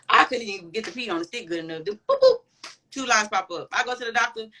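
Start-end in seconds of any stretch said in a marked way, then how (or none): 1.8-2.4 playful high boop boop sound; mimicked test beeps. Telling a friend about her pregnancy test, a high playful boop boop. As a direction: chirp the boops like a little alert.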